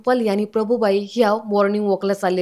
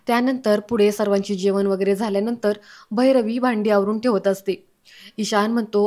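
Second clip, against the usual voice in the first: ordinary delivery, nothing marked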